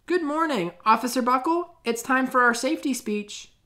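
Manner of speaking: high, bright phone voice of the principal; cheerful principal calling. Acted. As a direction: principal phoning the officer, high and chirpy. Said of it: "principal of Natville School telephoned".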